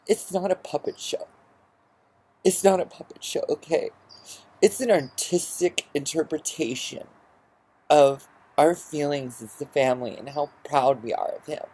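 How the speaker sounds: high whiny imitation of a tearful mother; mock her high and teary; mimicking crying mother. Son mimics his weepy mother defending the puppet show, high whiny voice. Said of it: "she starts crying".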